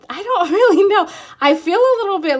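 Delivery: giggle, caught off guard and flattered; flustered laughing. Real, not acted. Reader blushing at a charming energy, laughing.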